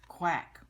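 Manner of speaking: playful loud duck call; duck quack. Pretend. Duck sound while reading book.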